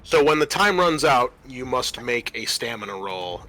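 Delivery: ordinary talk, nothing marked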